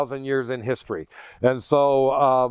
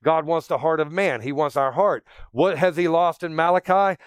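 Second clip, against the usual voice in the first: ordinary talk, nothing marked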